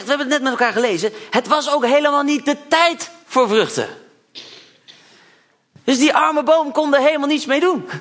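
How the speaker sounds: usual speaking voice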